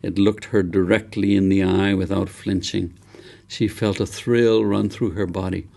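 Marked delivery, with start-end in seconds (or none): none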